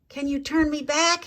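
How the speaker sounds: put-on John begging the butterflies to make him a boy again; frantic. A narrator's reading. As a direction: ask in a mild panic. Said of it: "he asked frantically".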